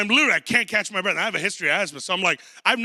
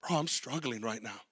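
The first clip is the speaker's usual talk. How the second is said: low, much softer admission to a friend; quiet confession; 6 semitones lower, 11 dB quieter than usual. Retold or imitated. Pastor confiding in a friend, quietly admitting struggle. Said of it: "lean on and go".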